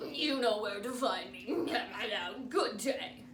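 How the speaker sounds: put-on loud, chuckling old doctor; jolly doctor. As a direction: chortle through the advice.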